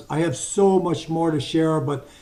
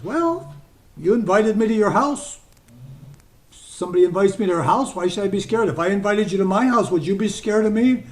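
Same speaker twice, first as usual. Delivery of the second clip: higher, louder, rising and unbothered; his own easy manner; 4 semitones higher, 3 dB louder than usual. Retold or imitated. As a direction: answer lightly with a rising, easy "Well". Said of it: "and I talk like this".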